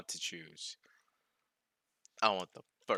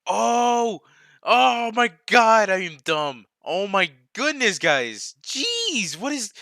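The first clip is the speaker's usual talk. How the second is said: loud, high, drawn-out disbelief; exasperated outburst; 10 semitones higher, 12 dB louder, much slower than usual. Real, not acted. Streamer realizing he was dumb, loud exasperated outburst. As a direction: cry out at your own dumb mistake. Realizes his own silly mistake.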